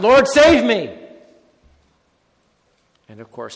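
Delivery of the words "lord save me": louder, higher "Lord, save me!"; a sinking man crying out to Jesus; cry for help. Acted. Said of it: "cry out".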